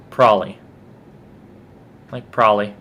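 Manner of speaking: "probably" slurred down to prolly; lazy form. Shown as a demonstration. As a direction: drop both Bs in "probably", prah-lee. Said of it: "if I'm just totally".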